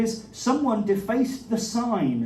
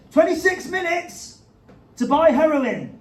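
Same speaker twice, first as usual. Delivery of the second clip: high, slow news announcement; dramatic headline read; 7 semitones higher, 3 dB louder, slower than usual. Pretend.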